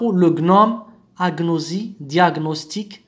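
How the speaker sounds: hard g then n in غنوم, أغنوزي, دياغنوستيك; separate g-n. Shown as a demonstration. Teacher contrasts exceptions with "ny", g and n kept apart. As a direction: sound both letters in غنوم, أغنوزي, دياغنوستيك, g as in "go". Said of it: "لا تنطق ني".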